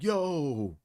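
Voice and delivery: high shouted yo, yo; mock shout. Roommate bursting in upset, shouting. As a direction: yell it out, worked up. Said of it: "He was just shouting".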